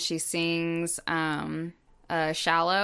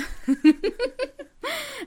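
a real giggle that shoots up high; high giggling laugh; 4 dB louder than usual. Real, not acted. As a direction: burst of high-pitched laughter. Laughing at her own joke.

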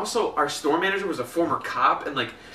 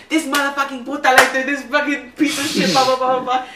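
higher, hammed-up cussing rant; mock furious coworker; 10 semitones higher, 5 dB louder than usual. Fed-up coworker cussing out a customer, hammed-up rant. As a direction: rant like a fed-up barista, slowed. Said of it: "she's like".

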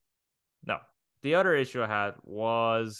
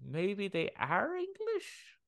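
puzzled musing; 10 semitones higher, 4 dB quieter, slower than usual. Retold or imitated. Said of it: "like, going".

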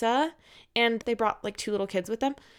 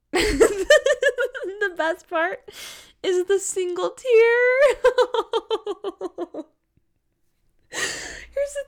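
much higher, louder, slow words through laughter; can barely get it out for laughing; laughing hard; 10 semitones higher, 4 dB louder, much slower than usual. Real, not acted. Host laughing at the patient's single tear, words squeezed through laughter.

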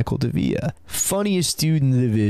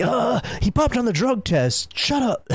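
dismissive instructors; 10 semitones higher than usual. Retold or imitated. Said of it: "And they're like".

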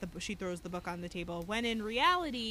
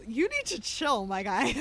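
laughing; 4 semitones higher, 7 dB louder, slower than usual. Real, not acted. Laughing at the show.

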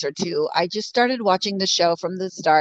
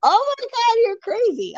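shocked disbelief. Retold or imitated. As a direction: exclaim in stunned disbelief. Reacting to the troll's accusation.

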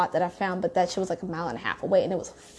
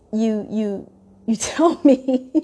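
slow, laughing through the words; incredulous laugh mid-sentence; laughing in disbelief; much slower than usual. Real, not acted. Laughing disbelief at his excuse.

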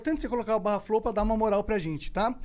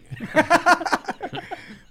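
laughing; 7 semitones higher, 6 dB louder than usual. Real, not acted. Still laughing.

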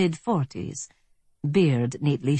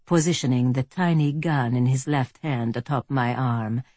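ordinary delivery

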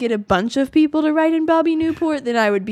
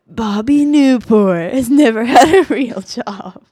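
slow, louder over-the-top radio ad; 7 dB louder, slower than usual. Shown as a demonstration. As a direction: boom it out like a campaign ad. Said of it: "Let me hear your over-the-top radio voice".

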